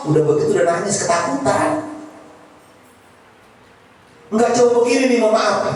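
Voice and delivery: ordinary talk, nothing marked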